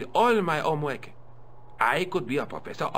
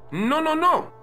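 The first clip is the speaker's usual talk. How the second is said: high, loud string of denials; indignant protest; 13 semitones higher, 6 dB louder than usual. Acted. Custodian corrects visitor's jibe about parking cars, indignant. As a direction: cut off the misunderstanding loudly.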